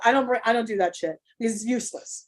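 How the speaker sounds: normal talking voice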